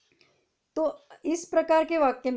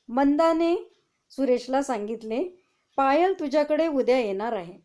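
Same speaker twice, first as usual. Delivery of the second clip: reading aloud; 3 semitones higher, 5 dB louder than usual. Shown as a demonstration. Teacher reads example sentence aloud.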